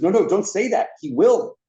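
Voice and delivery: high, urgent imitated warning; mock alarmed protest. Executive stops host from daring him, high mock-alarmed protest. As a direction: cut in high, playing up alarm.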